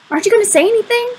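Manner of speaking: fast, high-pitched impatient demand; exasperated. A narrator's reading. Boy pressing his silent friend for a reaction, exasperated. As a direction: press for an answer, voice jumping up.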